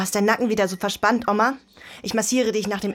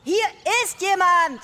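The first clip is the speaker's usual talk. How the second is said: calling out; 12 semitones higher, 4 dB louder than usual. Acted. Calling grandma from outside.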